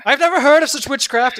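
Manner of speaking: high, loud outraged ranger; loud, hammed-up suspicion; alarmed shout. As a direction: cry it out in disbelief. Alarmed ranger answers the bard.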